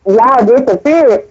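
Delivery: usual speaking voice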